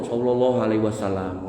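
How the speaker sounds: soft, low prayerful murmur of the salawat; quiet devout salawat. Shown as a demonstration. Preacher shows the right way to say salawat, softly. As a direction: breathe the blessing gently, inward. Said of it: "Solawatan sing bener niku ngeten niki".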